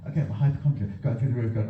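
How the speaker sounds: ordinary talk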